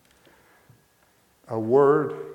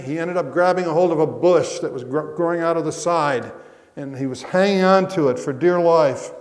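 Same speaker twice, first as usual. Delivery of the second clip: loud, high, driving sermon delivery; preaching at full force; 6 semitones higher, 10 dB louder than usual. Acted. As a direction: build the fall into a gripping tale.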